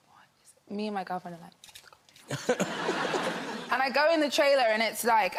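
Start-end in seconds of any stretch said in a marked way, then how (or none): 0.1-0.7 hushed, mock-stunned whisper; bewildered whisper. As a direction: whisper it as if retelling your disbelief. Bewildered reaction in retold story.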